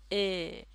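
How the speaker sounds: "ए" long central vowel. Shown as a demonstration. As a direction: hold "ए" with slightly rounded lips. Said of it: "ए की sound".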